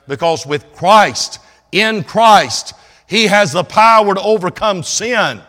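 loud, high, rousing preacher's climb; full-force preaching. Acted. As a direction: lift the crowd up with fervour.

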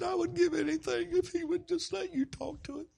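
high, anguished imitated lament; tearful regret. Wife wishing husband would listen, tearful regret.

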